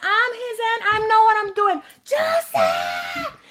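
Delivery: very high-pitched frantic shrieking; imitated shrill, hysterical protest; mock hysterical aunt. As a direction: screech back like a panicking aunt. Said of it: "Lo que hacía era gritándole".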